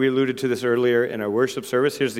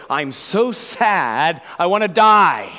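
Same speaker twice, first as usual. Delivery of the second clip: anguished cry, slow, high and loud; Jesus in distress; 7 semitones higher, 9 dB louder, much slower than usual. Acted. Preacher voicing a sorrowful plea to the disciples, anguished. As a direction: draw out the sorrow, then burst out louder. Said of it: "Jesus is greatly distressed".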